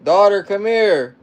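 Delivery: loud, slow call across the house; summoning the daughter from afar. Real, not acted. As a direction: call her over, stretched out. Calling daughter across room.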